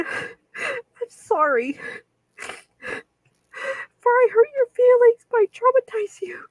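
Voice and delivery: real crying. Real, not acted. Begging Beyond's forgiveness, crying hard. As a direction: sob out the sorry, then pitch climbs high with tears. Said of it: "you hear me crying".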